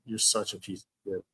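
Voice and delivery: soft, low, bitter repeat; quiet, fed-up contempt; subdued insult. Real, not acted.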